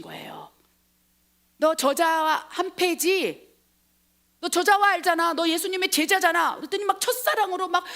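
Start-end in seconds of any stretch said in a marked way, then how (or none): 1.6-6.6 높고 크게 몰아붙이기; 다그치듯 따져 묻는 연기; 추궁하는 여종. Acted. 여종이 베드로를 추궁. (목소리 높여 추궁하듯)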